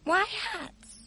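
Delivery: put-on high, small girl voice asking; a young girl's puzzled question; curious little girl. Sophie questions the giant.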